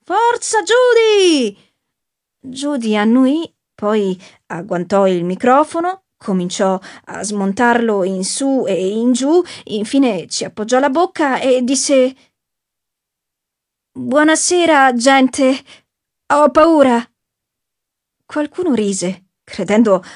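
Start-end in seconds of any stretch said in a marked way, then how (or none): 0.0-1.6 shout. A narrator's reading. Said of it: "gridò". 13.9-17.1 frightened singer. Acted. Judy on stage, frightened.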